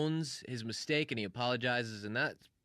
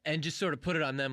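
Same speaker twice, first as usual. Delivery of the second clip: ordinary talk, nothing marked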